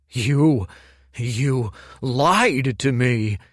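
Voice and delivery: spat accusation. A narrator's reading. Betrayed Kuruk accuses Dorian of lying, spitting loud and slow. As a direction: spit the accusation at him. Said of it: "Kuruk spat".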